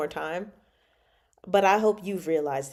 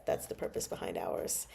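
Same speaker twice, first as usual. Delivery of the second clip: ordinary delivery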